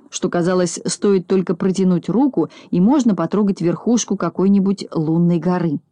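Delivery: ordinary delivery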